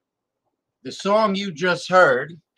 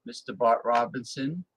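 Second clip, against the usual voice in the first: ordinary talk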